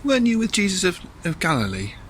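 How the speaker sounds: high, loud accusing question; challenging bystander. Acted. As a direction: start high and pointed, then drop back. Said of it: "someone comes up to him and says".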